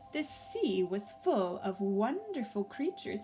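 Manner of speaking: plain reading voice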